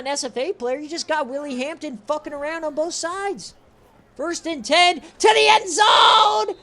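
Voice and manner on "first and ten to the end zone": high, loud, slow shouted call; play-by-play shout. Real, not acted. Streamer calling a long run in his football game, shouting. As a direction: roar it as the runner breaks free.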